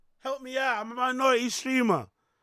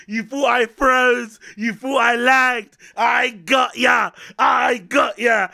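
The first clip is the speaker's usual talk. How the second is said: loud, slow, drawn-out streamer hollering; big loud hype, words dragged out; hyped shouted intro; 7 dB louder, slower than usual. Real, not acted. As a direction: stretch each phrase and shout it.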